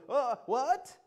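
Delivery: high, startled bewilderment; mock baffled reaction; taken aback. Acting Abraham taken aback.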